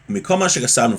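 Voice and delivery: ordinary delivery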